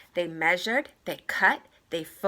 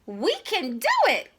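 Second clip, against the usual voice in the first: high, loud determined cheer; rally together; eager cry; 9 semitones higher, 5 dB louder than usual. Acted. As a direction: mice deciding to fix the dress. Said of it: "cried the mice".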